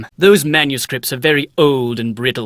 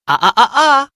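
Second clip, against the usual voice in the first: sneeze coming on; 10 semitones higher, 4 dB louder than usual. Acted. Sneeze building up.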